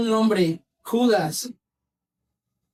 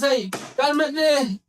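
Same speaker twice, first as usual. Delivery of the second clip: high, drawn-out order to settle; slow, raised-pitch command; acted rebuke; 4 semitones higher, much slower than usual. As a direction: stretch it out as a mock telling-off. Acted rebuke to frantic people.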